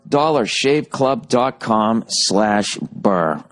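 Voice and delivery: slow, spelled-out web address; slow URL read. Shown as a demonstration. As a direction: read the address slowly for listeners. URL repeated slowly.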